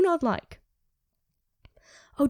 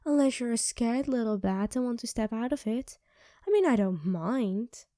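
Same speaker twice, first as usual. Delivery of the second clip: ordinary delivery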